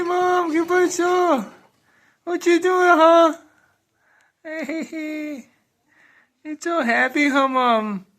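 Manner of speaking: high, slow sing-song cooing. Real, not acted. Cooing at baby daughter.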